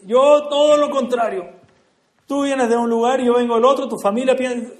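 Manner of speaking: high and loud, slowed, insisting they are opposites; the cab driver pushing back hard on the rabbi; loud protest. Retold or imitated. Taxi driver protesting loudly.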